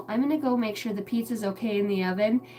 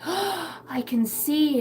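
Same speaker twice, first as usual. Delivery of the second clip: gasp, then high, very slow wonder; mock amazement; 8 semitones higher, much slower than usual. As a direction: play astonished for a little child. Mock amazement playing with daughter.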